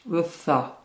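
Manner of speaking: "tha" with a very light S, tongue slightly out; lisped th. Shown as a demonstration. Teacher introducing an Arabic letter, lisped th. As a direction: let the tongue tip poke out on "tha". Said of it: "C'est un S très léger".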